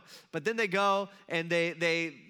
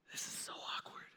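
hushed whispered aside from the crowd; awkward whisper; 14 dB quieter than usual. Acted. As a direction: whisper it under your breath, embarrassed.

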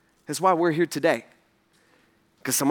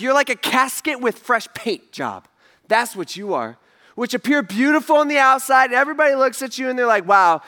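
preaching rebuke; 5 semitones higher, 3 dB louder than usual. Acted. Preacher voicing Jesus scorning the whitewashed tombs, preached. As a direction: lean into it, scornful and deliberate.

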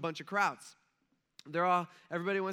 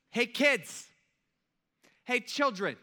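high, loud call across the water; retold yell; 7 semitones higher, 6 dB louder, slower than usual. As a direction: call out as if to kids, imitating a shout from shore. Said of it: "he yells out to these grown men".

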